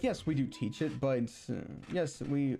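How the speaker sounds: ordinary talk, nothing marked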